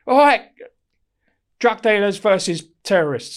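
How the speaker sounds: loud, high call across the yard; calling out teams. Retold or imitated. Officer calls out five-a-side teams to prisoners, loud and high. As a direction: shout the teams out like a PE teacher. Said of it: "Like this".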